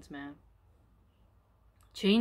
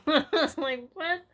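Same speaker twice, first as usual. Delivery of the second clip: laughing disbelief; 8 semitones higher, much slower than usual. Real, not acted. Reader laughing again.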